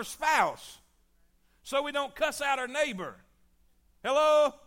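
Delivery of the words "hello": sharp loud "Hello?" to wake the room; loud call out. Real, not acted. Preacher calls out to quiet congregation.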